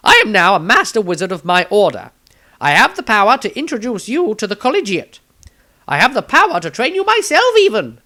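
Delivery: high, loud, grandly boastful wizard voice. Acted. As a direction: puff up and proclaim it like a master wizard. Maestro boasting his power.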